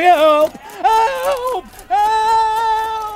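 shouting for help. Acted. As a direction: yell for help, high and panicked. Man calls for help from mob.

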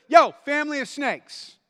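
loud high yell at the crowd; John the Baptist yelling at the crowds; shouted insult. Acted. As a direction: bellow it at them like a fiery prophet. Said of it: "So he says".

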